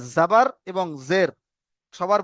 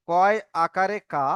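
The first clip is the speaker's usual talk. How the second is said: slow, drawn-out drill of কয় আকারে কা; slow recitation; slower than usual. Shown as a demonstration. Teacher spelling কয় আকারে কা slowly for students. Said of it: "যেমন আমরা পড়েছিলাম".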